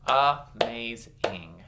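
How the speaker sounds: AMAZING slowly in separate syllables, starting loud. Shown as a demonstration. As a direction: clap out "Amazing" piece by piece. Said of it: "clap out some syllables".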